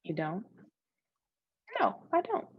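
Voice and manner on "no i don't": normal talking voice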